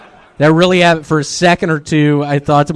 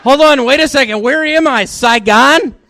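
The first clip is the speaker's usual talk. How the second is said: mock confusion; 11 semitones higher than usual.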